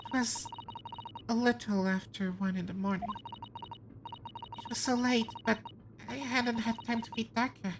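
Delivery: high, soft, hesitant character voice; confessing suspect. Acted. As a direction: tell it meekly in a small high voice. Voices suspect telling her story.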